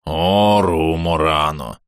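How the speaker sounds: low, slow grumbling under the breath; dark god muttering in annoyance; displeased mutter. Acted. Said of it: "пробормотал недовольно Аньрул".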